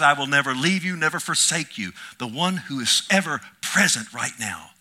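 loud, rising preacher's call; fervent sermon voice; preaching at full force. Acted. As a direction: proclaim it to the congregation. Preaches the crucifixion hours.

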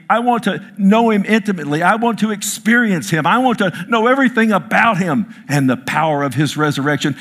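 pleading pulpit fervor; fervent preaching. Acted. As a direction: preach it with longing to know Him.

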